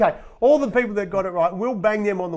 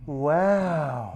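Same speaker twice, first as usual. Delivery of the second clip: ordinary delivery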